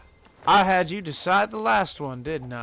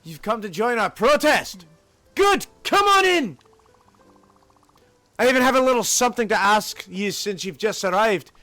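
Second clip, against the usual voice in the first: emphatic Scottish union organizer; Scottish freedom fighter; 5 semitones higher, 5 dB louder than usual. Acted. Organizer sending a newcomer to the CEO, rousing Scottish voice. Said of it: "I have a lot of fun doing the voice".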